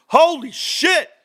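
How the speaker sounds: amazed exclamation. Real, not acted. Reacting to cash in bank.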